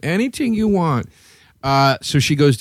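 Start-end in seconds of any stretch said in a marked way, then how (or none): 0.0-1.1 high coaxing voice; mock sultry high pitch; imitating her again. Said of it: "She said all that and then went".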